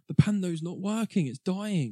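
high, gasping alarm; mock panic. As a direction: play it like sudden disaster. Mock gasp at plot twist.

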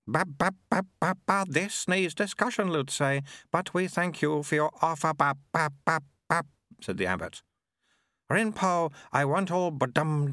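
high, babbling infant voice mixing words; babbling baby abbot. Acted. The infant abbot answering Lutze, babbling. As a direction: babble like a baby who is in charge.

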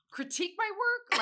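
high, loud outraged repeat; indignant. Real, not acted. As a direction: bristle at the word. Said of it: "But you're so angry".

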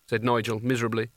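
ordinary delivery, nothing marked

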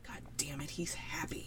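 hushed, bitter whisper; jealous whisper. Retold or imitated. Retelling her jealousy at his date, whispered.

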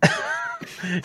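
breaks into a squeaky, helpless laugh; high squealing laugh. Real, not acted. Outing Cam's secret to friends, helpless high laugh. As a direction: lose it completely mid-sentence.

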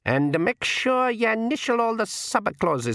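high, slow Scots-like pixie voice; old pixie. A narrator's reading. Old pixie tells the king where to initial, high dialect.

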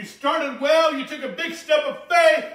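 loud, high, slow preacher's build; preaching at full force. Real, not acted. Preacher encouraging the congregation, loud and slow. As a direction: lift the room with each word.

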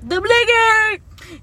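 high, slow, loud ad callout; hype announcer. Acted. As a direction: sell it like a cheesy commercial. Mock commercial jingle callout.

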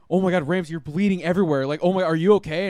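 mock-frantic, alarmed exclaiming; retold freaking out. Mom finding her child bleeding, imitated panic. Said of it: "she's, like, freaking the fuck out".

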